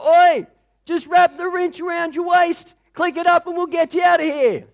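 high loud yell over the rotor din; shouting over noise. Acted. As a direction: bellow down from the chopper. Rescuer shouting from helicopter.

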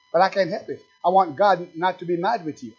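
ordinary delivery

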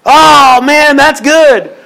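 high, slow, sing-song friend's reply; chirpy friend. Retold or imitated. Friend brightly reacting to the lady's news, high and slow. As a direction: play the cheery friend, drawn out. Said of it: "friend voice in the joke".

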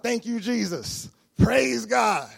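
loud, high mock rejoicing; mimicked praise. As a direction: imitate a jubilant pastor, building to a shout.

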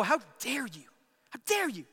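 acted indignant rebuke; mock indignation. Imagined indignant father scolding son.

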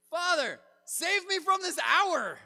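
high, loud desperate plea to the Father; desperate cry. Shown as a demonstration. Preacher shows Jesus begging loudly, a desperate cry. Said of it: "Or do I say it with an exclamation point?".

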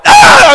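sudden high yelp of terror; loud mock scream of fright; scared scream. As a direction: shriek like a terrified shepherd.

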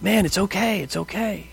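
put-on quiet a little boy down gently; gentle reassurance. A father calming his upset six-year-old, soft and tender. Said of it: "And I'm like".

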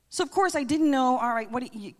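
ordinary talk, nothing marked

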